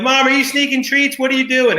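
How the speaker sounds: high-pitched mock child voice; kids accusing mom. Kids calling to mom over crinkling wrapper, high accusing voice.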